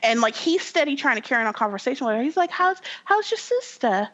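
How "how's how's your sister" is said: high-pitched mock British small talk; halting, put-on British voice, pitched way up; British accent. Game hero trying to chat with his ex, mock British. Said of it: "that's my British accent".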